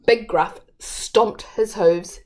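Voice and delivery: ordinary delivery, nothing marked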